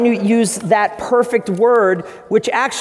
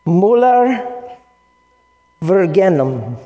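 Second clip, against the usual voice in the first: Portuguese attempt; much slower than usual. Shown as a demonstration. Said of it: "I would say it like this".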